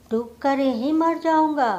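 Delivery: usual speaking voice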